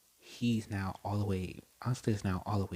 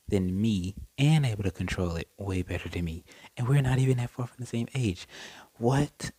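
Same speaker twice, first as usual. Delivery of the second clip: rising from stunned disbelief to a louder indignant outburst; getting mad; 6 dB louder than usual. Retold or imitated. Royal girl stunned a commoner outdoes her, building to a mock angry outburst. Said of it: "And she starts to get mad".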